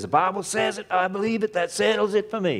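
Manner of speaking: mock Southern fundamentalist pastor, pitched high; southern accent. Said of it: "of course, with a southern accent".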